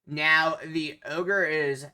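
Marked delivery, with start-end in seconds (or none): none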